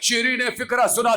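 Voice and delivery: usual speaking voice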